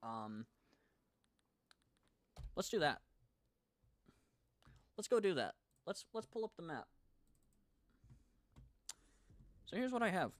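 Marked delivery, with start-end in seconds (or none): 2.5-10.3 higher and louder, eager; excited. Real, not acted. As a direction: jump up in pitch with eagerness. Eagerly pulls up the map.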